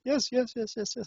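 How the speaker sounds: high, soft rapid string of assent; hurried, anxious people-pleasing, acted out; mock nervous. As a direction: squeak out nervous reassurance. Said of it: "I was so nervous".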